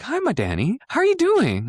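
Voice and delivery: very high, bright mom impression; mom's greeting. Imitating mom greeting son on the phone, very high. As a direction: squeal a sugary hello to her son. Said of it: "She's like".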